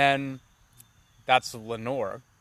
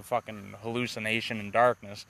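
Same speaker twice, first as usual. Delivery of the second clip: ordinary delivery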